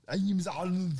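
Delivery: hammed-up action-hero impression; Arnold character voice. Player introducing a plasmoid druid, hammed-up Arnold voice. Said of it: "in that accent for that voice".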